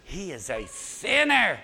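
sinner dragged out slow and sneering; stress on sinner. Retold or imitated. The crowd sneering at Zacchaeus, slow with sinner stressed. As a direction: lean hard on sinner. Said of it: "they just emphasize that word".

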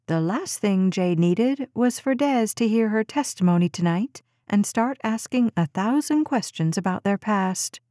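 ordinary delivery, nothing marked

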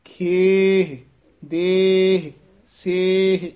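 ਖੇਹ, ਦੇਹ, ਸੇਹ said loud with long vowel and plain ਹ; clear final h. Shown as a demonstration. Teacher drilling students on ਖੇਹ ਦੇਹ ਸੇਹ endings. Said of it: "ਇਹ ਉਚਾਰਨ ਹੈ".

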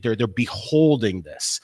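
ordinary talk, nothing marked

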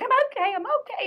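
very high, loud flustered protest; shooing everybody off. Retold or imitated. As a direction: imitate an embarrassed woman waving off help. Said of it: "she's trying to shoo everybody".

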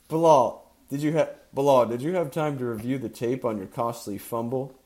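loud, biting sneer at the player. A narrator's reading. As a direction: sneer it down at him. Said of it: "sneered the R B coach".

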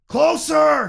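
very high, very loud call; shouted. A narrator's reading. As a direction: scream it across the wind. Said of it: "came Han's voice".

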